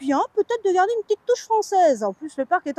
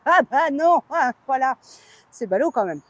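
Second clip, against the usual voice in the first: normal talking voice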